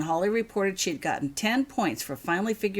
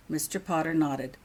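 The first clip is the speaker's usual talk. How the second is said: usual speaking voice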